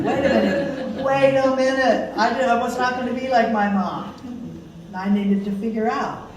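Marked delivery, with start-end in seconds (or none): 0.0-4.1 a laughing, higher "wait a minute" as it dawns on her; laughing realization. Retold or imitated. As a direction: catch yourself mid-thought and laugh at it. Sudden laughing self-realization.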